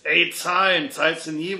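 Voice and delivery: loud demand for payment, easing off; demanding call. A narrator's reading. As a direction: call out sharply after a non-payer. Landlord demands money from leaving drunk.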